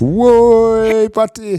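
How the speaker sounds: sudden high, loud whoa; excited whoa. Real, not acted. Co-host cheering the patty, excited high whoa. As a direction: burst out with delight.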